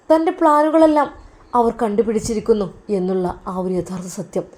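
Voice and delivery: plain reading voice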